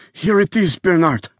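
put-on high, pained Eastern European accent. Dying visitor passing Bernard the note, Eastern European accent. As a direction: hand it over pleading, Eastern European accent. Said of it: "Jolene detected an Eastern European accent".

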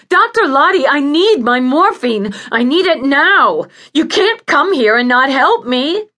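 high, loud insistent pleading; frantic patient crying out for help; desperate demand. Acted. Patient demands morphine from doctor.

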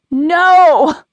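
high, loud cry of panic; screamed no. A narrator's reading. Lily screams as young Lily keeps walking away, panicked high cry. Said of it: "Lily screamed".

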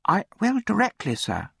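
put-on high little boy's voice; timid orphan boy. A narrator's reading. Orphan answers the caller at the door, high meek reply. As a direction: answer obediently in a high child's pitch.

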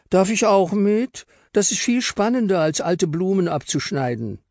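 put-on a girl begging to be let in on the fun; eager girl Lotta. Lotta pushes past Luis to join, eager and high.

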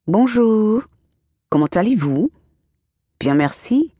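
practiced schoolbook French, bright start; sounding out French phrases with care; careful French. Acted. Girl practicing French alone under her blankets, careful French. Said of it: "practiced the phonetic pronunciations".

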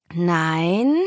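long stretched no, rising; drawn out. Acted. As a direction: draw the word out slowly. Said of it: "sagte er gedehnt".

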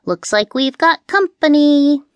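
high cat voice, mildly nervous. A narrator's reading. Cat warning witch of birds, mildly nervous. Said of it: "Kiki said nervously".

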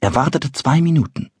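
usual speaking voice

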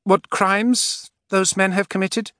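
high, slow, steady question; loud and steady. A narrator's reading. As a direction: ask firmly and loudly. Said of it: "in a loud, steady voice".

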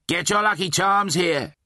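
high street vendor's call; hawker's cry. Acted. Slaughterer selling charms to passers-by, high hawker's cry. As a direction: call out your wares, pitched well up. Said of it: "he cried".

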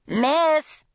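a sharp, high call for the waitress. A narrator's reading. Irate customer calling the waitress, sharp and high. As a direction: snap out to get attention. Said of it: "A sharp female voice".

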